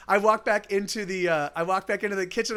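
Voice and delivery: ordinary talk, nothing marked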